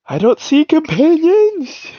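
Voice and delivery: high whiny confusion. Real, not acted. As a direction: drag it out, baffled and high. Player can't find menu tab.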